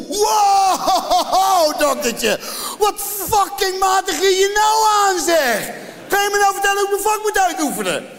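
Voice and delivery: furious rant. Retold or imitated. Comedian yells at the throat doctor, furious shout. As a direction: blow up at him in outrage. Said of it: "Ik zeg".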